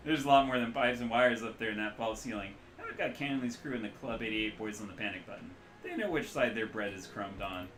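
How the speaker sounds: put-on Midwestern accent. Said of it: "loose Midwestern accent".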